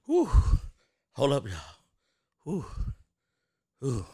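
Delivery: winded, puffing between words; winded from dancing to the song; out of breath. Real, not acted. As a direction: blow out hard to catch your breath. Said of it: "I got out of breath".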